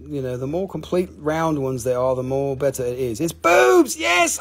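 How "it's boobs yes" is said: high, loud blurt of delight; gleeful shout. Real, not acted. Host shouting to viewers what the rock looks like, loud gleeful blurt. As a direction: burst out laughing-loud, finally allowed to say it.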